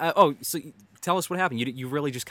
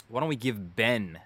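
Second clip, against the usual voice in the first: usual speaking voice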